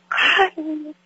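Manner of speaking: high mock call, as if searching; lightly voiced call across a room; calling out a name. Calling for the missing brother.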